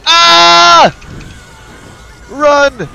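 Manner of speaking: high, loud alarmed shouts; panicked yelling. Real, not acted. Player yelling at teammate mid-fight, panicked shouting. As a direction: yell in sudden panic.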